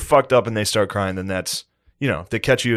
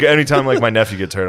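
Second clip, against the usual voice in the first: ordinary talk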